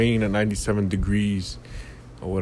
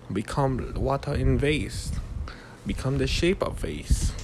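put-on higher, softer Bruce Lee accent; 4 semitones higher, 3 dB quieter than usual. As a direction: play Bruce Lee as a wise master. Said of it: "I'm doing a Bruce Lee accent".

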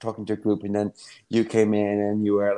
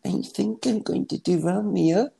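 hesitant, higher and slow; mock timid audition; 9 semitones higher than usual. Drama student hedging at an audition, mock timid. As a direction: sound unsure of yourself. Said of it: "be like".

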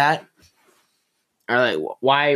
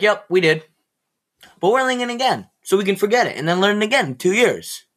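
sarcastic classmates; 7 semitones higher than usual. Retold or imitated.

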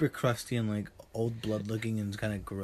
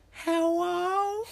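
high squeaky voice. Retold or imitated. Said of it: "like a Chicago accent".

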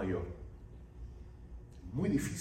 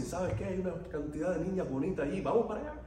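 higher-pitched, coaxing retold invitation; eager invitation; 6 semitones higher, faster than usual. Brother invites him along to church, eager coaxing. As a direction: imitate an eager brother talking someone into coming.

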